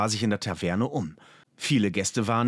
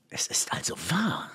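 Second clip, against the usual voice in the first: hushed, awestruck whisper; stunned breath; 5 semitones higher, 4 dB quieter than usual. A narrator's reading. Explorer alone before the strange apparatus, stunned whisper. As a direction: breathe it out in disbelief. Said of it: "hauchte er entgeistert".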